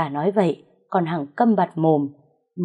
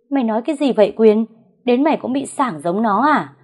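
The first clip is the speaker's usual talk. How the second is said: higher, louder and fast, a father's disbelief; shocked outburst; 5 semitones higher, 4 dB louder than usual. A narrator's reading. Father rounds on his son in angry disbelief.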